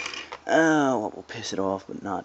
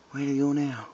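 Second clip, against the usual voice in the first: ordinary delivery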